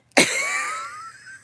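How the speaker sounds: high, rising shriek of laughter. Real, not acted. Streamer reacting to the pregnant remark, shrieking laugh. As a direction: burst into a squealing laugh.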